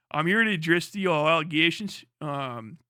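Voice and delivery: halting put-on Baltimore voice; Baltimore accent. Streamer addressing allegations as a Baltimore local, put-on accent. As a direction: do a full Baltimore accent, hedging with fillers. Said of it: "do complete Balmer".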